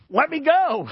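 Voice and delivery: put-on high, loud child's whine of protest; a squirming kid pulling free, high and loud; little boy protesting. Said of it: "and he's like".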